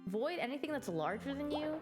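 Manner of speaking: upbeat narrator read-along; game narration read. Acted. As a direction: read it like a cheerful game intro. Reads game narration aloud.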